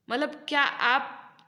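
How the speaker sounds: ordinary talk, nothing marked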